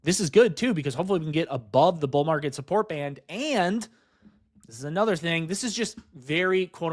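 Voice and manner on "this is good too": usual speaking voice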